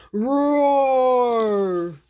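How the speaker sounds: loud booming monster roar; sea monster roar. Acted. Sea monster roars again.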